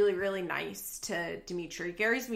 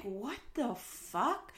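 slow disbelief; 3 semitones higher, 6 dB quieter, much slower than usual. Retold or imitated. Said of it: "That is when I was like".

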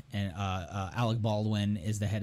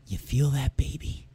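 breathy creepy whisper in the ear; 3 semitones higher than usual. Retold or imitated. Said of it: "lean in your kid's fucking ears and say".

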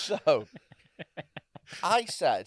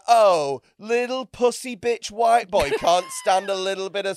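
louder, higher sneering mock voice; mocking contempt; 5 semitones higher, 8 dB louder than usual. How S0 imagined the server saw him, sneering and louder. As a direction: sneer it out, as he imagined she thought it. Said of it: "she was like".

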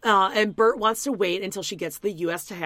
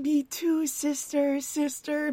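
mock sweet agreement; 8 semitones higher, slower than usual. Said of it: "they're going".

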